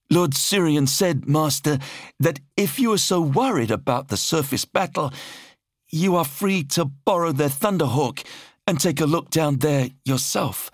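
high-pitched, careful relay of insolence; nervous officer. A narrator's reading. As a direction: pass on an insult to a feared master, voice high. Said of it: "minor tremor in his voice".

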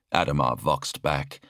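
ordinary delivery

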